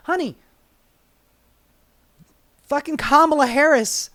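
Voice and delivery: normal talking voice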